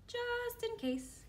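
put-on very high, slow little-girl voice; sly, satisfied little girl; Lily voice.

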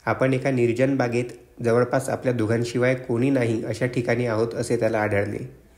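usual speaking voice

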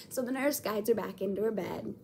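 ordinary delivery

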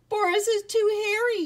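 high-pitched, loud mouse yell. A narrator's reading. Another mouse complaining about the bear, yelling. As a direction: yell it out as an alarmed little mouse. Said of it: "yelled another".

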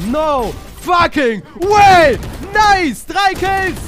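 roaring hype, very loud; excited shout. Real, not acted. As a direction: yell it out as the kills land. Caster erupts at triple kill.

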